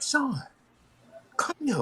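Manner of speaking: voicing her high and soft, calling him back; a high girlish call of his name; high woman's voice. Woman calling him back to the room, high and soft. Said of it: "She said".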